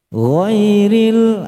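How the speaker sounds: "Ghairil" chanted high as "ghoiril"; long high "Ghairil", sounded ghoy-ril; dark vowel ghairil. Shown as a demonstration. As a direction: sing "Ghairil" with a rounded o-like first vowel. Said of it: "Baca goir aja".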